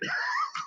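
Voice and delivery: soft, high-pitched chuckle; real laugh. Real, not acted. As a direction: laugh quietly after the punchline. Laughs after quoting his son.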